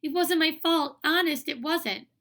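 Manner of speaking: high, loud, panicky denial; upset protest. A narrator's reading. Girl blamed for the fall, high panicky plea. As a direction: plead your innocence frantically. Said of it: "Sheila cried".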